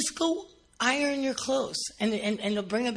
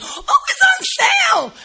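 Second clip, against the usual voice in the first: much higher, louder slow squeal; ecstatic shopper; 11 dB louder, slower than usual. Retold or imitated. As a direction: cry out in worship over a sale. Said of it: "raise your hands like".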